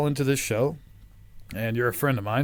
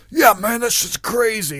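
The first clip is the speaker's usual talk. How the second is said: higher, louder hyped-up agreement; mock crazy excitement; 10 semitones higher, 7 dB louder than usual. Retelling how he egged on his conspiracy friend, hammed-up excitement. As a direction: play it like a wide-eyed convert. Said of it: "I'm like".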